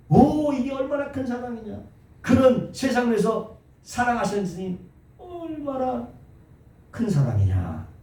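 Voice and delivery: high, marvelling "오"; exclamation of wonder. Shown as a demonstration. Preacher exclaiming the verse's meaning to his church. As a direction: cry out at how great the love is. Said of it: "감탄문을 써서".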